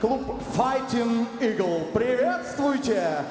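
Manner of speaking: slow, stretched ring announcer; drawn-out announcer call. Acted. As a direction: stretch every word out. Announcer drawing out words.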